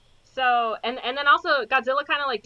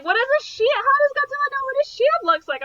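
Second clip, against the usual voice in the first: incredulous; 7 dB louder than usual. Retold or imitated. Quoting John's incredulous questions.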